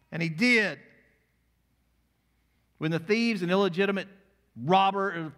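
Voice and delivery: loud, high, emphatic pulpit voice; impassioned preacher, leaning hard on key words; full-force preaching. Acted. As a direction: drive each phrase out to the whole room.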